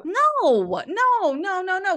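rapid high, loud string of no's; laughing protest, no no no, up high and loud; emphatic no. Real, not acted. Protesting she never used the phrase, loud and high.

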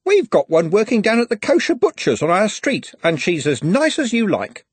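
a busybody with firm views; lightly voiced prim old lady; gossipy macaroon lady. A narrator's reading.

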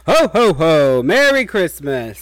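booming festive Santa cheer; jolly ho ho ho. Pretend. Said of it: "I'm Santa".

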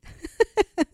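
sudden squeaky laugh; high burst of laughter. Real, not acted. Laughing at the absurdity.

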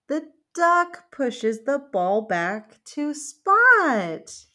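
slow, loud drawn-out narration; slow storybook reveal. A narrator's reading. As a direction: savour the happy ending. Dramatic slow read-aloud.

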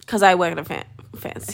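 usual speaking voice

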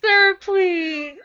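mock plea. Player begs in mock distress.

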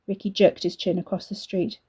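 usual speaking voice

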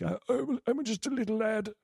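put-on high, soft childish prince voice; little lad voice. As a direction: play a meek boy prince. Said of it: "Wandering over like".

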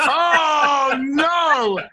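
loud laughing outcry; laughing shout. Real, not acted. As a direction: burst out laughing and yell. Teasing Robert, laughing outcry.

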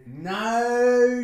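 loud, high, drawn-out refusal; emphatic refusal. Pretend. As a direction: stretch it out and push it up. Gleefully refuses to let listeners go.

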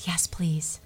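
voiceless, frail whisper. Retold or imitated. Weary patient accepting prayer in a whisper. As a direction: barely breathe the answer.

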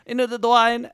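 high-pitched mock Borat accent. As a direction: echo it in a bad Borat accent. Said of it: "It just turns into Borat".

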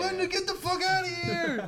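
high, laughing mock yell. Staff throwing the homeless lady out of the store, laughing high yell. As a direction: shriek it out through laughter. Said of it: "And they're like".

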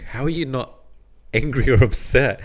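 wife baffled her husband isn't angry, surprised and startled. Retold or imitated. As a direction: ask it like you can't believe he's calm. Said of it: "she was so surprised and startled".

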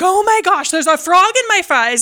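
high, loud startled gasp of words; shocked cry. Retold or imitated. Mom finding a frog in her fries, shocked and loud. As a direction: blurt it out in alarm at the fries. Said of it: "I'm like".

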